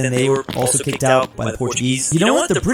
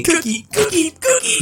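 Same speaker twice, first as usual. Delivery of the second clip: very high-pitched, squeaky repeats; excited cartoon character. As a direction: chirp "cookie" higher on the last one. Comic character happily repeats new word.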